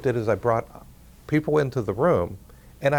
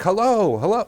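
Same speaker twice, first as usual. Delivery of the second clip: imitating a frustrated boy shouting hello, higher and louder; mock yell into phone; 9 semitones higher, 5 dB louder than usual. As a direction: yell it like an upset caller, retold. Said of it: "screaming into the phone".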